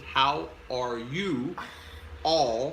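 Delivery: "How are you all" very slow, word by word; spelled-out explanation. Shown as a demonstration. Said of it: "What does that mean?".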